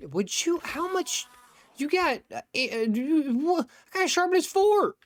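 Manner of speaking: very high, squeaky mock outrage; playful falsetto indignation, stumbling over words; incredulous squeal. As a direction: protest in disbelief, voice shot way up. Mock outrage at hits taken in game.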